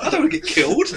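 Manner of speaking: high laughing whine; laughing while defending himself. Real, not acted. Laughing excuse.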